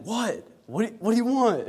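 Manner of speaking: put-on high, confrontational kid comeback; mock bickering. Brother answering brother in the back seat, high irritated challenge. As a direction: fire back in a mock sibling squabble. Brothers calling each other names.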